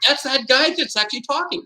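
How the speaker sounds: mock giddy, high-pitched exclamation; imagined amused onlookers. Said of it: "you guys will all be laughing, going".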